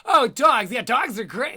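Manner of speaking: mock cat person, building from mild protest to loud indignation; getting worked up. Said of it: "she kinda gets worked up more and more".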